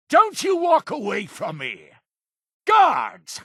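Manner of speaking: high, furious shout; angry command. Acted. Leader to someone walking away, calling guards, outraged shout. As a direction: shout them down and summon help.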